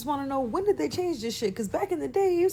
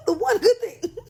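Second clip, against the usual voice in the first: high, louder, cracking up with laughter as you repeat it; laughing repeat; 12 semitones higher, 7 dB louder than usual. Real, not acted. Comedian to viewers, repeating the line while laughing hard. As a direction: burst out laughing on the echo.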